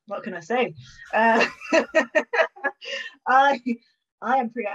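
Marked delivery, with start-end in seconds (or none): 1.5-3.2 bright giggle rising high; embarrassed laugh. Real, not acted. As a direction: laugh it off shyly. Guest laughs at the question.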